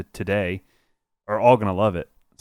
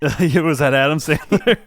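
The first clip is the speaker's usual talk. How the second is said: laughing; 5 semitones higher, 3 dB louder, slower than usual. Real, not acted. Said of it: "laughing at impression".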